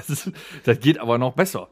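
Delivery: ordinary talk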